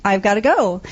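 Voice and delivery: ordinary talk, nothing marked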